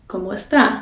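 "¿Cómo está?" Dominican style, no final S; Dominican dropped S. Shown as a demonstration. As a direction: say "está" with the last S of estás left off. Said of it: "really sound Dominican, drop the S at the end".

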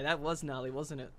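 ordinary talk, nothing marked

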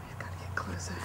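hushed whisper, sneaking closer. Real, not acted. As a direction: whisper so nothing hears you. Sneaking through graveyard at night.

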